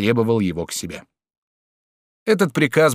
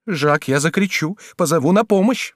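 rising, urgent woman's voice; urgent warning; 7 semitones higher than usual. A narrator's reading. Woman threatens lover with screaming, urgent. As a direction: threaten with mounting panic.

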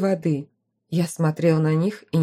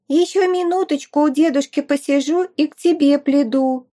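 put-on high, earnest small-child voice; a toddler being very grown-up; serious little girl; 8 semitones higher than usual. A narrator's reading. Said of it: "серьёзным выражением лица согласилась маленькая непоседа".

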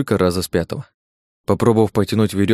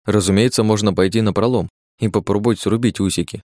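ordinary delivery, nothing marked

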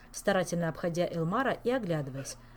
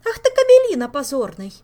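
loud high furious yell; 9 semitones higher, 17 dB louder than usual. Acted. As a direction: explode at him in rage. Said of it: "разъярилась Ольга".

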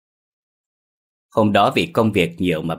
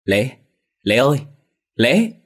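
calling out a name, rising with each call; mounting call, ending high and loud; 6 semitones higher, 5 dB louder than usual. A narrator's reading. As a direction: call into the dark for a lost child. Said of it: "đưa chiếc áo ra mà gọi lớn".

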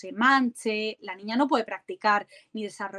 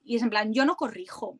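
ordinary talk

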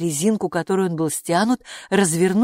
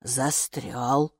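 low, slower, doubting echo; distrustful; 5 semitones lower, 4 dB quieter than usual. A narrator's reading. Gatekeeper doubts the root is stuck, low distrustful echo. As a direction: repeat it low with a questioning rise. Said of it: "недоверчиво поговорила привратница".

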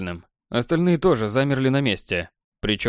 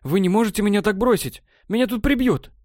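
panicked pleading; 9 semitones higher than usual. Acted. Elf begs not to be abandoned.

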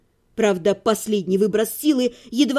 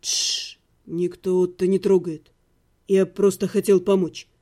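low, soft hush; calm her down gently; soft and gentle; 7 semitones lower than usual. A narrator's reading. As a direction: necromancer softly reassures a panicking girl. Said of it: "мягко сказал".

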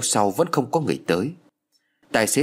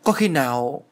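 sudden alarm; 4 semitones higher than usual. A narrator's reading. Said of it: "chợt hốt hoảng nói to".

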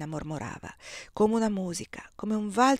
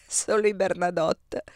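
said through a laugh; 3 dB louder than usual. Acted. Husband laughing as he jokes about his own family. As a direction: laugh the words out. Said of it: "rise Giambattista".

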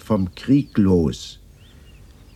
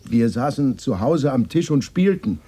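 agitated outburst; 8 semitones higher, 4 dB louder than usual. Acted. Upset retelling of the night.

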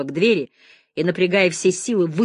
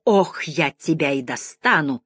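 slow, drawn-out menace; menacing threat; slower than usual. A narrator's reading. Janine threatens the lieutenant while restrained, slow menace. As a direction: stretch the threat out slowly.

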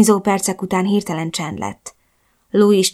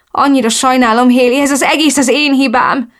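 higher, upset, guilt-stricken voice; distraught apology; 5 semitones higher, 3 dB louder than usual. A narrator's reading. Blaming herself to her crying, beaten friend, distraught. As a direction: plead for forgiveness, pitch raised.

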